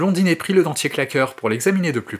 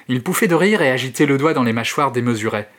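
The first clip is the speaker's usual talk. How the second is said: usual speaking voice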